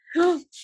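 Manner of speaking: sharp, high gasp; real gasp of delight. Real, not acted. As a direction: gasp loudly in delighted surprise. Delighted surprise at new stickers.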